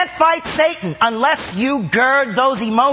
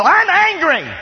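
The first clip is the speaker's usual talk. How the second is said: angry outburst; 7 semitones higher, 5 dB louder, slower than usual. Acted. Voicing a believer admitting anger.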